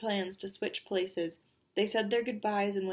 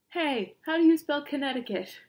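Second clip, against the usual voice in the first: louder, higher call for help; 5 semitones higher, 6 dB louder than usual. Acted. State writing invitations asks for spelling, calling out. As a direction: call across the room, puzzled.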